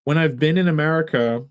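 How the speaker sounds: ordinary talk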